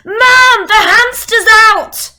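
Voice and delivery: very loud, high, drawn-out yell; child's alarmed shout. Acted. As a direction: raise the alarm like a kid yelling downstairs. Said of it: "take on their voice".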